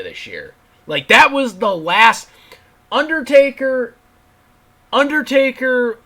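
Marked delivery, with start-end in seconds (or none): 2.9-6.0 high, loud name call; loud, high emphatic naming; emphatic exclamation. Real, not acted. Ranting, names last kayfabe holdout.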